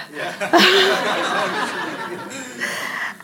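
long amused laugh. Real, not acted. Preacher laughing at her own desperate prayer, amused laugh. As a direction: laugh it off warmly. Laughs after story punchline.

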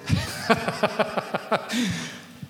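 embarrassed little laugh; breathy laugh. Real, not acted. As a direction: laugh at yourself. Laughs at own singing.

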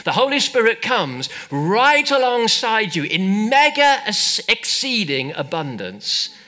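high, loud, stretched-out preaching; slow emphatic sermon peak; impassioned preaching. Real, not acted. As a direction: drive the promise home, slowly and forcefully. Preaches at full force.

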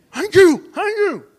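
loud, high mock outburst of thanks; overwhelmed thanks. Big builder gripping the preacher's hand, hammed-up loud thanks. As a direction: belt out an imitation of tearful gratitude.

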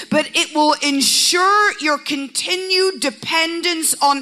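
high, impassioned preacher's crescendo; full-force preaching. Real, not acted.

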